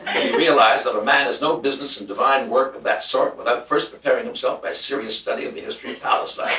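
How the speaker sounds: ordinary delivery, nothing marked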